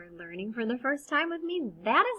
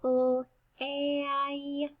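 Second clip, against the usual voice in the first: "P, l, a" stretched sound by sound; long held vowel, separated consonants; slow sounding out; 3 semitones higher, slower than usual. Shown as a demonstration. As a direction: draw out each sound of "play". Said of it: "I said that kinda slowly".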